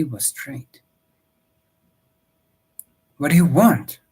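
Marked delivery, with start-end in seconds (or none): 3.2-4.0 loud, higher, forceful question; raised voice. Acted. Husband shouting at the raiders, loud and firm. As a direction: raise your voice at the riders. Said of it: "raising his voice".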